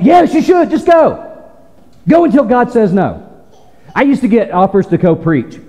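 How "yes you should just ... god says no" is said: emphatic urging. Acted. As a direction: shove them out the door with conviction. Answering imagined hesitant believer.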